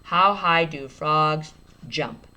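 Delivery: steady slow reread; slow read. Shown as a demonstration. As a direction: read it back word by word. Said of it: "I just add an S".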